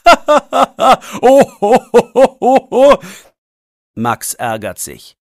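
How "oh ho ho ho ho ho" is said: big hearty ho-ho laughter, high and loud. A narrator's reading. Man laughing loudly at the boys' statue. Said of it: "Dann muss er laut lachen".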